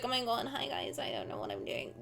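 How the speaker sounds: small, soft imitation of a shy greeting; really quiet. Streamer imitating their nervous self on a call, really quiet. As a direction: shrink the voice right down, mimicking nervousness. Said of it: "really quiet and was like".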